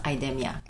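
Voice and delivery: IDEMIA with short stressed E, eye-DEM-ee-uh; correct pronunciation. Shown as a demonstration. Guest answers host on the company name, IDEMIA pronounced eye-DEM-ee-uh. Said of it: "it's pronounced".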